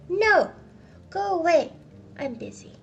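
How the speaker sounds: high, loud shout; angry refusal. Acted. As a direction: drive him out with a shout. Scrooge sends nephew away.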